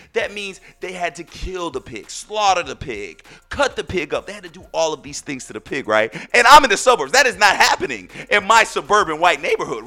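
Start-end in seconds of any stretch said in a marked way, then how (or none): 6.3-9.9 loud, higher-pitched protest; emphatic denial. Retold or imitated. Suburban man rejecting the pig-cooking idea, loud indignant denial. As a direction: insist it is impossible, hammed-up outrage.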